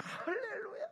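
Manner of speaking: high, drawn-out cheer; mock elated hallelujah. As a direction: sing out praise in imitation of a delighted parent. Mimics delight over a child's success. (신나서 외치는 흉내)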